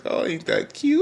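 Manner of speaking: squeaky cooing. Retold or imitated. Vlogger gushing over the couple, falsetto coo. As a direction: coo it way up high like melting over cuteness. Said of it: "I was like".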